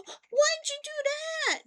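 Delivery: very high, drawn-out indignant squeal; mock-wounded cry, loud and stretched; shocked protest. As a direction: imitate a bitten husband's wailing protest.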